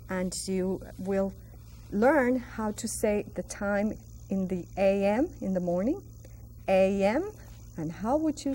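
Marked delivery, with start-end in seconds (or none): none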